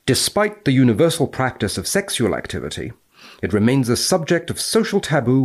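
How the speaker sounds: crisp academic recitation; formal read-aloud. Acted. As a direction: read it out like the journal text. Reading the paper's text aloud.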